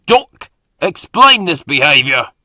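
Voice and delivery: loud, stern boss demanding answers; angry shout. A narrator's reading. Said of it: "he shouted".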